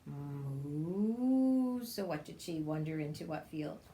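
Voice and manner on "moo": ordinary delivery, nothing marked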